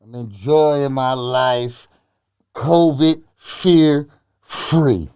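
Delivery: slow, stretched out; slow drawl. Acted. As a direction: drag every word out slowly.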